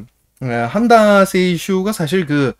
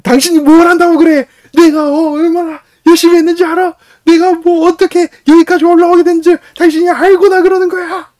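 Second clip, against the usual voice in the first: much higher and louder, yelling in a rage; furious shouting; 9 dB louder than usual. Retold or imitated. Young calligrapher yelling at the old director, furious shout. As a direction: scream it at him, hurt and enraged. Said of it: "소리 질렀어".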